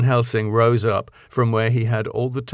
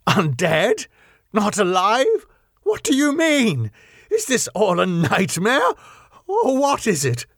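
high-pitched bewildered questions; horrified disbelief; 6 semitones higher than usual. A narrator's reading. Arthur demanding what undead means, horrified and bewildered. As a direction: climb higher with each appalled question.

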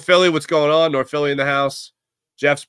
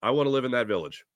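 usual speaking voice

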